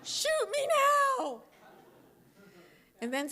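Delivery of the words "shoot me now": very high, loud comic plea; mock despair. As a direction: squeal it like a hammed-up cry for help. Stuck at New Year's with seniors.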